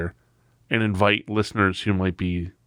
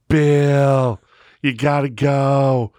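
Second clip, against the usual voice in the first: loud, slow, insistent drawl; imitated former boss; 4 semitones higher, 6 dB louder, much slower than usual. Boss pressing a sleeping employee, loud slow drawn-out imitation. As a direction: stretch it out loudly like the pushy boss. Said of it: "he used to talk like this".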